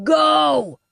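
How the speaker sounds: high shout through the fire; yelled. Acted. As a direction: yell across the flames to send her off. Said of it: "Curran yelled at me".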